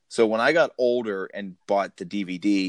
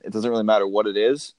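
normal talking voice

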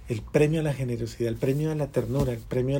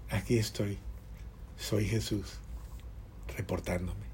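hushed Jesus; 6 semitones lower, 12 dB quieter than usual. Retold or imitated. Jesus speaks at the deathbed.

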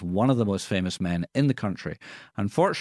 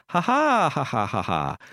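forced laugh; 4 semitones higher than usual. Acted. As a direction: fake ha-ha, starting high and falling away. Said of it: "And you would go".

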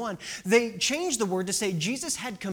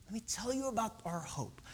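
much softer, intimate delivery; hushed repeat; 12 dB quieter than usual. Acted. Preacher repeating Mark's promise of hope, hushed. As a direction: say it gently, almost under the breath.

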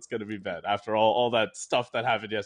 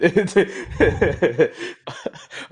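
burst of laughter; 5 semitones higher, 7 dB louder than usual. Real, not acted. Caught off guard by question.

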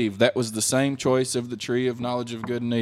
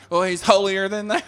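high mock-scandalized sneer; put-on judgmental jab; mocking accusation; 6 semitones higher, 5 dB louder than usual. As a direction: imitate a catty critic, pitched up. Mimics people judging Jesus.

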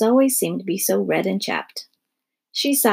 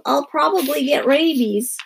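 put-on high-pitched snuffly child; snuffling; 5 semitones higher than usual. A narrator's reading. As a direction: snuffle out a self-pitying worry. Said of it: "Melody snuffled".

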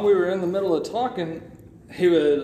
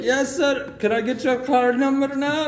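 higher, polite mock Indian accent; imitating Indian scammer; 8 semitones higher than usual. Scammer asking the caller for his card, mock Indian accent.

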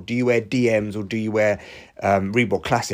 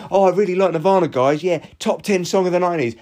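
mocking impression; 6 semitones higher, 3 dB louder than usual. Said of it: "And he's there going".

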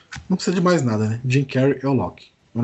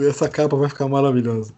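ordinary delivery

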